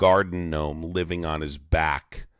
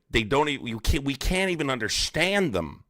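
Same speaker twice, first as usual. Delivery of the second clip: usual speaking voice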